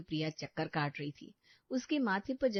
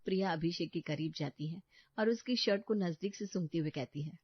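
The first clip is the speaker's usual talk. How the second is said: usual speaking voice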